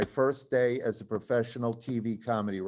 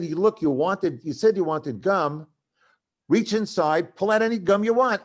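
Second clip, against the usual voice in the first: mock argument, building higher and louder; insistent; 5 semitones higher, 6 dB louder than usual. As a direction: press the point with growing impatience. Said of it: "had our first fight".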